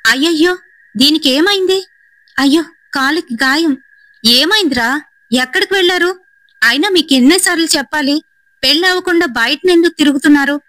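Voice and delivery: high, alarmed scolding aunt; high worried aunt scolding; loud scolding. Acted. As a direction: fret then scold the youngsters. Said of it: "గట్టిగానైతే గసురుతోంది".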